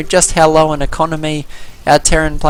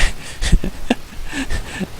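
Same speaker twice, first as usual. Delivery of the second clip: high laugh; 9 semitones higher, 6 dB quieter than usual. Real, not acted. Laugh at the player's comment.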